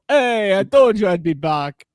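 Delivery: put-on loud, high, hearty hello to old friends; returning regular, a loud, high, hearty hello; loud greeting. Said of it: "walk in after, like, months and go".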